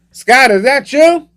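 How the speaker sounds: high, slow, loud call; mock-nervous high holler. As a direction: call warily into the next room. Calls nervously to absent manager.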